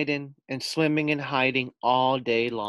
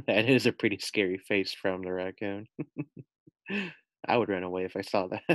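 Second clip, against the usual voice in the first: laughing; 2 semitones lower, faster than usual. Real, not acted. Reader laughs at scary face.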